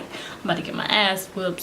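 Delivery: whispered to herself in dread; scared whisper. Retold or imitated. Kid whispering to herself before the whooping, scared. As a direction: whisper it like you're bracing for trouble.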